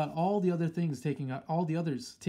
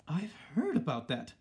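excitedly; 2 semitones higher than usual. A narrator's reading. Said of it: "cried out excitedly".

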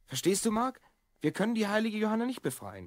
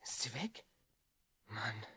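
whisper; 7 semitones lower, 12 dB quieter, slower than usual. Acted. Hiding until the sister leaves.

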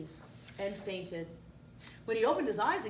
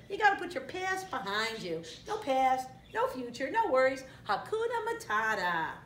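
punchy, raised cartoon sidekick voice; meerkat motto; 5 semitones higher, 4 dB louder than usual. A narrator's reading. Meerkat gives his motto.

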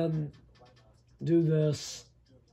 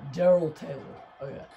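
normal talking voice

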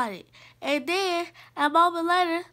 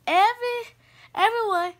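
raised, higher-pitched teacher call; calling to class; 6 semitones higher, 3 dB louder, faster than usual. Acted. Teacher introducing the new pupil to class, calling out. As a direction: get the whole room's attention.

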